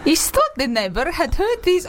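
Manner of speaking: attempted parrot accent slipping into Spanish. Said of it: "she's gone Spanish".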